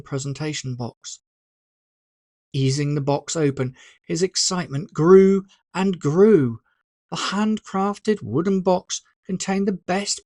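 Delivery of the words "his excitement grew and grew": usual speaking voice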